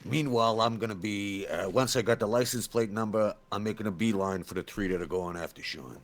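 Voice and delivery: ordinary delivery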